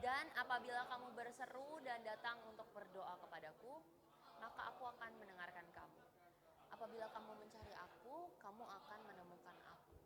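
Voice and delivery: distant group reading of the verse; a lightly heard reading, far from the mic; reading together. Real, not acted. Congregation reads Jeremiah aloud together, heard faintly. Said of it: "Baca sama-sama".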